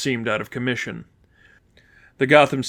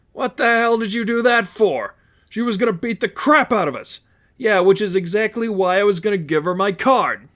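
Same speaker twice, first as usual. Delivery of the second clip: much higher, strained argument; a heated thug quarrel, pitched up; bickering thugs; 8 semitones higher than usual. Acted. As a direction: squabble in raised voices. Thugs argue after gunshot.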